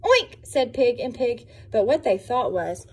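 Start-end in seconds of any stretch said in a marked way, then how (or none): 0.0-0.4 very high, loud oink; playful squealing oink; pig oink. Acted. Pigs oink.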